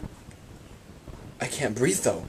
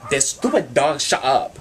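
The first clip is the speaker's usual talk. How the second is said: snapping at dog; 4 semitones higher, 7 dB louder than usual. Real, not acted. Yelling at barking dog.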